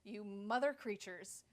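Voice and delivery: high, loud, furious outburst; loud retold rant. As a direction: let it burst out at them.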